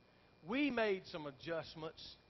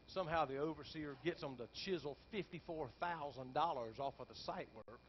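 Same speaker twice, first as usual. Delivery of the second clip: ordinary talk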